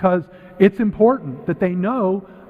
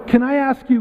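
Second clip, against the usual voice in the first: higher, louder mock interruption; judge cutting in; 7 semitones higher, 3 dB louder than usual. Judge stopping a bad singer, cutting in. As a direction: break in to halt the singer. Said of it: "they'll stop them and go".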